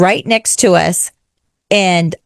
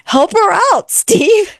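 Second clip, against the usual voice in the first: much higher, pleading push; nudging husband Steve toward the screaming lady; urging whisper-nudge; 12 semitones higher, slower than usual. Retold or imitated. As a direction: nudge your husband to go help. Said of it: "I nudge on Steve and I'm like".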